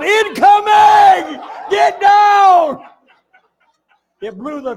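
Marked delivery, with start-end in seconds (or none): 0.0-2.8 huge shout, much higher and louder; yelled warning. Retold or imitated. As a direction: bellow it like a Marine under fire. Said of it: "I actually yelled".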